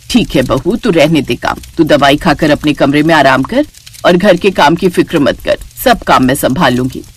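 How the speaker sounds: put-on low, reassuring older woman's role; kindly mother-in-law. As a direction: send the sick daughter-in-law to rest. Mother-in-law comforts sick daughter-in-law.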